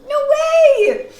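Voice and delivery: very high, loud squeal; shouted refusal. Real, not acted. Playful shouted rejection of faking.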